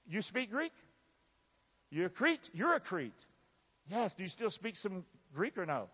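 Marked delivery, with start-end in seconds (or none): none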